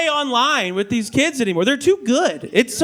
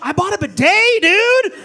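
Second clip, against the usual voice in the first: overexcited gush; 9 semitones higher than usual. Retold or imitated. Blurting out bidet love in small talk, high excited shout. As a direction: burst out with bidet joy. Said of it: "You can't be like".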